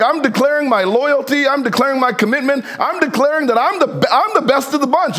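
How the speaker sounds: insistent boasting. Acted. Peter declares his loyalty to Jesus, insistent boasting.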